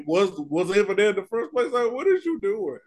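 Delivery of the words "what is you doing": ordinary delivery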